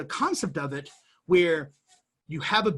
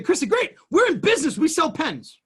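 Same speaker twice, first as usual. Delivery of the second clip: high, loud, eager announcement; hammed-up glee at opening shop; mock excited; 9 semitones higher, 5 dB louder than usual. To Christy, pretending they just started selling pens, mock excited. Pretend launching a pen business.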